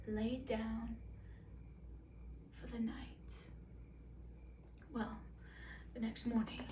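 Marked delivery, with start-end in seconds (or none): none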